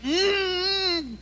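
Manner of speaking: high muffled whimper through clenched teeth; high mouth-shut whimper of panic; desperate muffled cry. Acted. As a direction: panic without opening the mouth. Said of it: "empieza a desesperarse y le dice".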